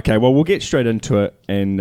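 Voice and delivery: ordinary delivery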